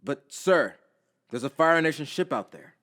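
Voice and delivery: mildly alarmed subordinate; hesitant soldier. A narrator's reading. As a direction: object to a superior's order. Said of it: "The soldier pauses".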